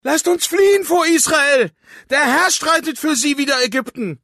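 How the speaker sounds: very high, loud, terrified shout; panicked shout. Acted. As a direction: scream it while fleeing in panic. Fleeing Egyptians shout.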